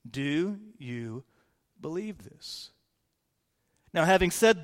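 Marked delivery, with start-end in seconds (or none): 0.1-2.7 much softer, almost a whisper; hushed repeat. Shown as a demonstration. Pastor quietly repeats Jesus' question to the congregation, hushed. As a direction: ask it again softly, letting it sink in. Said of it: "In the words of Jesus".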